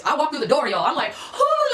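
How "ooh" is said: high mock-nervous ooh; high startled ooh; scared ooh. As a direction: squeak it out like you're nervous. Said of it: "like, I'm scared".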